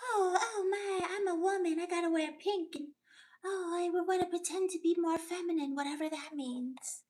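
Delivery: mock a simpering woman near tears; sarcastic high weepy voice; mock whiny woman. Mocking feminine caricature again.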